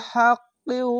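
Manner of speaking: হাক্কিউ with the qaf joined to the u, said high and clipped; correct pronunciation demo. Shown as a demonstration. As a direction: say হাক্কিউ as one joined syllable chain. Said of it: "এভাবে উচ্চারণ করবেন".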